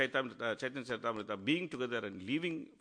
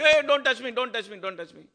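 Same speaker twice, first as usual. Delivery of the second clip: high, loud alarmed cry; alarmed protest; 10 semitones higher, 11 dB louder than usual. Retold or imitated. Humble Haridas fleeing Mahaprabhu's embrace, alarmed high protest. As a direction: shrink away crying out not to be touched.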